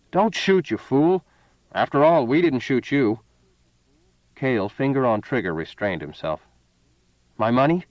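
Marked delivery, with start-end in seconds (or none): 0.1-3.2 higher and louder, pleading fast; panicked plea. A narrator's reading. Seal pleading with Cale at gunpoint, panicked. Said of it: "said quickly". 7.4-7.9 a louder, terse demand; snapped. A narrator's reading. Cale demanding his money from Seal, snapped. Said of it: "he snapped".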